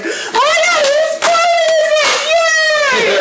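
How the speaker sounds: squealing way up high; high excited squeal. Retold or imitated. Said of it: "so I was like".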